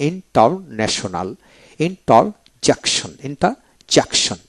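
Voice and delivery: "International" and "interjection" slow, with pauses inside; broken into parts. Shown as a demonstration. Teacher modelling polysyllables, "interjection" slowly split up. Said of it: "এইভাবে উচ্চারণ করবেন".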